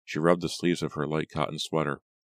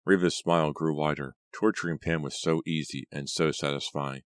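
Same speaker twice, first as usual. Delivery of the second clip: plain reading voice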